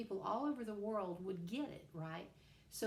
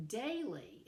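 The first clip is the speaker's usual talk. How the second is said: "daily" pitched up, stress on DAI; stress on first syllable; 4 semitones higher than usual. Shown as a demonstration. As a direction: punch the "dai" of "daily", then let it fall. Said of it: "with a stress on the first one".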